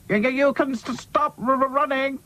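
high stuttering character voice; crackling stutter. A narrator's reading. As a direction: stick on syllables like a crackly hi-fi. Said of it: "crackled Hi-Fi".

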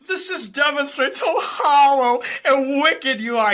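high, loud, whiny mock-crying voice; imitating a rival on the verge of tears; mock tearful whine. Mocking the rival's tearful rant to the stream, whiny and loud. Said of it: "He's literally, like, crying".

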